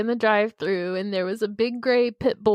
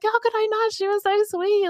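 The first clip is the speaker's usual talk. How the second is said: much higher, gushing and kind; sweet barista; 13 semitones higher than usual. Retold or imitated. Barista remembers Penny fondly, high gushing voice. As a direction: gush sweetly about a lovely dog. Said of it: "And she's like".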